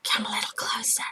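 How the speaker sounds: breathy conspiratorial whisper. Retold or imitated. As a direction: lean in and whisper the secret. He's like.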